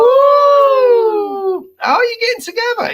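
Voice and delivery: long, very high "ooh"; playful falsetto nosiness; teasing excited coo. Real, not acted. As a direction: squeal it high, then a quick high-pitched question. Teasing reaction to a couple announcement.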